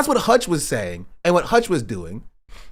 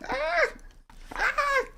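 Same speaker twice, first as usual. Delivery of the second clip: squeaky yelps; 6 dB quieter than usual. Real, not acted. Dog jumps at her.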